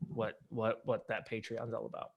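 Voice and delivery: ordinary delivery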